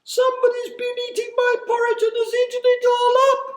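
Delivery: put-on very high, loud, fast baby bear squeal; high piping bear voice. A narrator's reading. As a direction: pipe it up like a tiny upset bear cub. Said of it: "he cried in his high piping voice".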